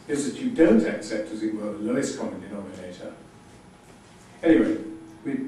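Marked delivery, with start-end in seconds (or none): none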